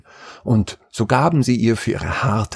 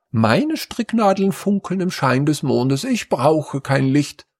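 first daughter's voice; 7 semitones higher than usual. A narrator's reading. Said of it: "sagte die erste Tochter".

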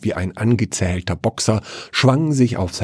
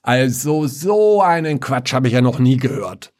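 father protesting from his grave; 6 semitones higher than usual. Acted. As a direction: huff up from below the ground. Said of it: "die Antwort aus dem Grab".

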